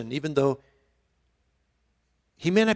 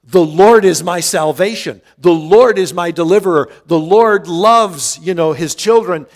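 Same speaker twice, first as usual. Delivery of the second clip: bold proclamation; 8 dB louder than usual. Retold or imitated. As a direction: proclaim it loudly, full voice. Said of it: "and yet he says".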